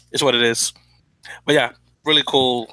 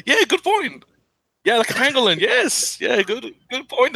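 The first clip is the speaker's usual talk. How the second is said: amused high voice; 9 semitones higher than usual. Real, not acted. Amused by pangolin joke.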